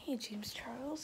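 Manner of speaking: usual speaking voice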